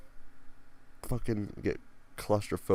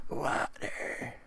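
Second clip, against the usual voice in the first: dry croak; 8 semitones higher than usual. Pretend. Croaky plea for water.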